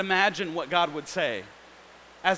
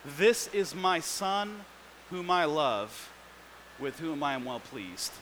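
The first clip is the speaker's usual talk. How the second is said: very slow, softer, fading loving words; tender Father; 4 semitones lower, 6 dB quieter than usual. Retold or imitated. The Father speaking over Jesus, slow and tender. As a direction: let it settle gently, almost hushed. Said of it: "the Father looks at Jesus and says".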